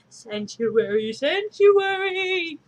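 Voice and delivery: slow, drawn-out, rising wail of sanctuary; anguished Quasimodo cry. Acted. Hunchback book begging for sanctuary, anguished wail. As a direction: howl it like a desperate bell-ringer.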